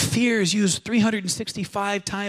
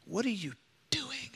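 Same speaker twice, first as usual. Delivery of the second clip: hushed whisper at your own reflection; whispered to the mirror; 12 dB quieter than usual. Retold or imitated. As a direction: breathe it out to yourself, almost unvoiced. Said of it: "just said".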